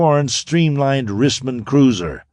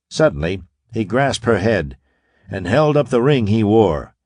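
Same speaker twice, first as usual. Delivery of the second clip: ordinary delivery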